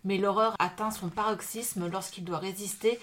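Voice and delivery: measured reading voice, slowing at the end; dramatic back-cover reading; back cover read aloud. Acted. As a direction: read the blurb like a book trailer.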